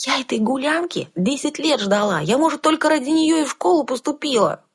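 put-on high indignant girl; young girl voice. A narrator's reading. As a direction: protest like a sulky girl. Heroine protests.

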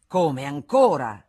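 loud, drawn-out incredulous question; exasperated surprise. A narrator's reading. Federico reacting to yet another caller, exasperated surprise. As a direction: exclaim it slowly, in disbelief.

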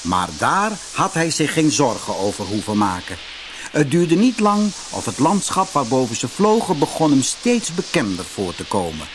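ordinary delivery, nothing marked

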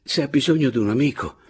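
urging. A narrator's reading. He offers himself as a friend, urging. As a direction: coax her to open up. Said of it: "la incitai".

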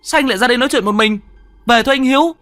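very high, fast, pressing call; urgent high plea. Acted. Narrator begging Hiếu to come home, high and fast. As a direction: rush the words, pleading him to come home.

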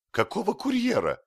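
high, loud horrified exclamation; appalled yelp of a question. A narrator's reading. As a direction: cry out aghast, pitch shooting up. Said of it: "ужаснулся Изнурёнков".

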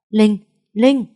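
high, loud call of a name; urgent calling. A narrator's reading. Man calling the fainted woman's name, alarmed. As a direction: call out to wake her.

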